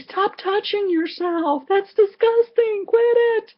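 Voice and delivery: high, loud mock scolding; shrill-free high holler, mock scold; hollering at myself. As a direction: yell at yourself like a scolding parent. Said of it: "I'm hollering at myself saying".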